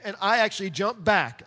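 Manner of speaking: ordinary talk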